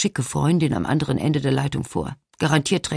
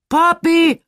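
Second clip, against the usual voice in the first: put-on loud high yell of the child's name; bellowing; 13 semitones higher, 7 dB louder than usual. A narrator's reading. Mother yelling at her child mid phone call, bellowing. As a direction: yell out sharply, pitched far up. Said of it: "brüllt sie".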